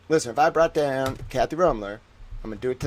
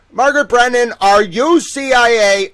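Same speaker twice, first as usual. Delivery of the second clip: higher and louder, slow accusing call; mock accusing shout; 8 semitones higher, 10 dB louder than usual. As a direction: call her out across the room. Calling out accusingly.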